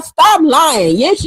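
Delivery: ordinary talk, nothing marked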